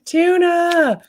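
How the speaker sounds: high, loud, drawn-out call of one word; brain crying tuna. Acted. Hungry streamer voicing her own brain, high loud cry. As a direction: belt it out high like a craving taking over. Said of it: "my brain's like".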